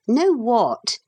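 a touch of impatience in the question; impatient. A narrator's reading. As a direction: ask with mild impatience. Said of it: "he asked impatiently".

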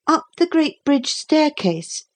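put-on high-pitched small boy's reply; answering boy. A narrator's reading. Boy telling the captain his route, high-pitched.

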